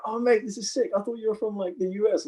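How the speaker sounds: high-pitched mock excitement; imitated thrilled fan. Club fan meeting the actor, mock excitement. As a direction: gush like a fan, pitched well up.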